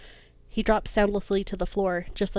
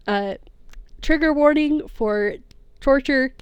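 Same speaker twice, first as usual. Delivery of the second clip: ordinary delivery, nothing marked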